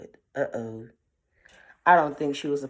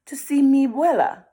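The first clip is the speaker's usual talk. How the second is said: put-on higher, louder girl's voice; suspicious Red; 8 semitones higher, 5 dB louder than usual. A narrator's reading. Red answering the stranger, suspicious and high. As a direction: answer with a wary edge. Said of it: "Red suspiciously said".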